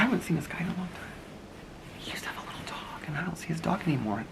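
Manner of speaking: fast, hushed whisper. Real, not acted. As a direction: whisper so the man nearby won't hear. Gossiping about a passerby.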